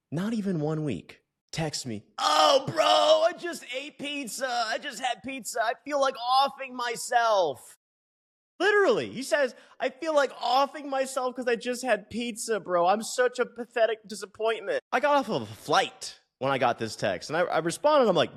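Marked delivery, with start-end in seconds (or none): 2.2-7.8 high, whining mimicry of a panicked client; mock whiny client. Coach mimics his client's pizza panic text, high whiny voice. 9.8-14.8 high, whining mimicry of a panicked client; mock whiny client. Coach mimics his client's pizza panic text, high whiny voice.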